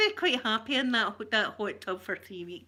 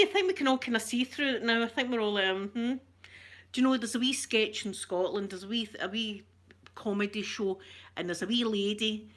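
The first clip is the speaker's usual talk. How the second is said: ordinary talk, nothing marked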